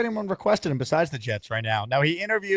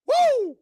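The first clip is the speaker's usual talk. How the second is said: very high, sustained "woo"; excited whoop; 4 dB louder than usual. Real, not acted. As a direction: let out a high-pitched hype whoop. Hyping the caller's arrival.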